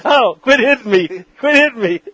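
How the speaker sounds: high-pitched mock protest; hammed-up high squeal; mock whine. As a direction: whine like a kid being poked. Mock complaint of being hit.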